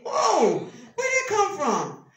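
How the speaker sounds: high, loud mock shock; taken aback. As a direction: exclaim as if blindsided by a message. Acting shock at all-caps text.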